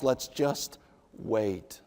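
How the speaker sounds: slow and soft, trailing off; husband softly urging patience; soft plea. Pretend. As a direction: ease into "Let's just wait" gently. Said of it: "for a godly husband to say".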